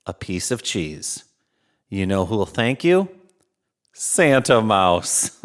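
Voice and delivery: ordinary delivery, nothing marked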